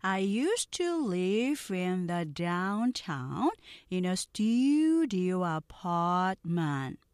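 very slow, carefully sounded English lines; slow model reading. Shown as a demonstration. Teacher reading slowly for students to repeat. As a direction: read each sentence slowly for repeating. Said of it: "느린 속도 듣고 따라해".

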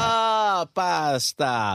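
hammed-up Italian accent on drawn-out words; playful mock-Italian, very slow. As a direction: stretch it out like a stagey Italian. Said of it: "Let me see your Italian accent".

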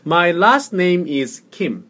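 very slow, projected English model; slow model reading. Shown as a demonstration. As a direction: lead a repeat drill slowly and firmly. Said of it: "해보세요".

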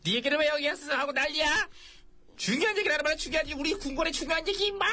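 high-pitched telling-off; exasperated scolding. Acted. King berates aide for bringing his own news, exasperated scolding.

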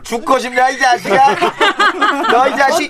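high, menacing thug; gangster threat. Acted. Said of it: "너무 무서워".

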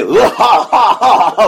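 big fake belly laugh; drawn-out show-off guffaw, put on; forced loud laugh. Schoolboy hanger-on laughing to look cool, forced loud laugh. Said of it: "일부러 애들 들어가듯이 크게 웃고".

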